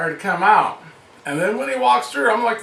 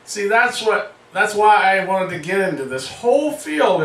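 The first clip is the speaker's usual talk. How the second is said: usual speaking voice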